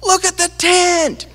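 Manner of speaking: put-on high, slow, excited child's yell from far off; hollering with glee from across the field; imitating a kid shrieking with excitement; excited scream. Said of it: "you could hear him a quarter mile out there scream".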